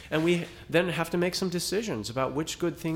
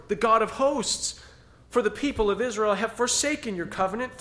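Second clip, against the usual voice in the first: raised, pleading prophet's voice, a touch overwrought; Elijah's lament; 8 semitones higher than usual. Acted. Elijah pouring out his complaint to the Lord, raised and aggrieved. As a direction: cry out your loneliness to God. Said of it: "And Elijah says".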